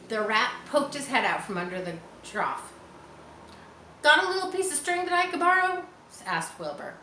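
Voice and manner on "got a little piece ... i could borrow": higher, fast eager pig voice. A narrator's reading. Pig asking the rat for string, eager and quick. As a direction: rush the request like an eager young pig.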